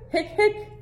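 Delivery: hiccup sounds, very high; squeaky hiccups. Acted. Hector hiccups.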